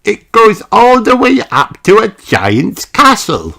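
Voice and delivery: put-on high, bright little pig girl calling out; Peppa voice. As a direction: play the excited little piglet.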